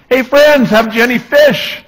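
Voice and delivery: high carrying shout from the beach; calling out from shore. Retold or imitated. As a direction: yell it out to the boat like a nosy stranger. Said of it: "he's yelling out to them".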